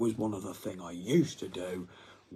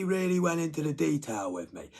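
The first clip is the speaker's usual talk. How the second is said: ordinary talk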